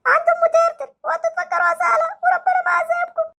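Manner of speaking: put-on hurt boy threatening the doctor with his dad; sulky hurt child. Same child.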